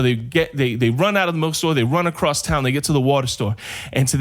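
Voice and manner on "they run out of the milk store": ordinary delivery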